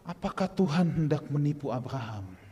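normal talking voice

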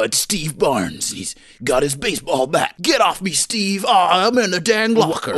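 dramatic trailer voice, slow and high; movie trailer announcer. Pretend. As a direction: narrate it like an epic trailer. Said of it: "why do you sound like a movie trailer announcer".

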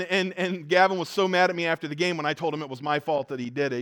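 usual speaking voice

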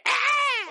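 put-on a sudden high scream from the old internet ad. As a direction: scream like the ad's ghost. Recreating jump-scare ad.

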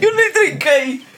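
high, slow, loud protest; disgusted shout. Real, not acted. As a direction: wail it out in disgust. Disgusted after tasting insect.

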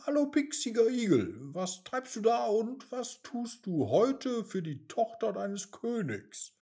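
cheeky, quick teasing question. Acted. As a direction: tease the hedgehog like a spoiled brat. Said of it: "fragte Mimi frech". (frech und neckend)

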